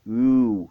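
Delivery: long, loud "U" held as an oo sound; sustained teacher's demo of "U"; stretched U. Shown as a demonstration. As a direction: draw out the vowel "U". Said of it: "vous allez étirer la prononciation du U".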